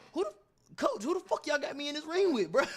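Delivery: high, laughing panic; panicked high squeal. Retold or imitated. To the coach, realising the opponent is experienced, laughing high panic. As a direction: yelp it at the coach in alarm.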